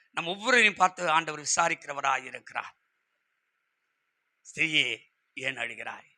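hushed, low preaching with pauses; tender consolation. Acted. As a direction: let it sink softly, almost to nothing. Preacher consoles as Jesus would. (கனிவாக, மிகத் தாழ்ந்த மெதுவான குரலில்)

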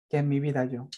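ordinary talk, nothing marked

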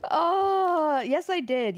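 ordinary talk, nothing marked

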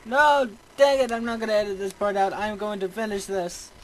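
loud, high outburst easing slightly; real exasperated protest; frustrated determination. Real, not acted. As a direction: burst out, stubbornly refusing to quit. Refuses to give up after dying.

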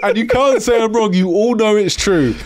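high, knowing tease; teasing. Real, not acted. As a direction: needle the listeners playfully. Teasing the audience.

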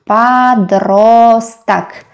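"Подросток" stretched syllable by syllable; very slow teacher's model of "Подросток"; slow sounding-out. Shown as a demonstration. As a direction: draw out each vowel of "Подросток". Teacher sounds out new word.

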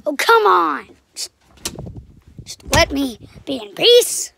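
loud frustrated plea; exasperated shout. Real, not acted. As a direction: beg loudly to be left alone. Exasperated at interruption.

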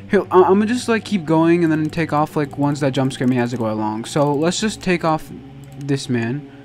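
ordinary talk, nothing marked

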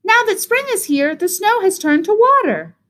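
high, delighted bird cheep; high-pitched gleeful little bird. A narrator's reading. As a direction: cheep it with wonder. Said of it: "Bird cheeped".

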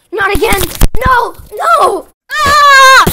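loud, high mock screaming; terrified screams. Host screaming as he is attacked at the end.

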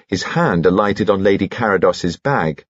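plain reading voice

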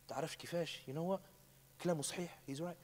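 soft, hushed agreement; café man murmuring that he's right; quiet murmur. Retold or imitated. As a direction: mutter it under your breath.

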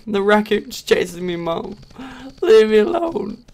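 high panicked whine; being chased. Real, not acted. Raccoon chasing, calls mom.

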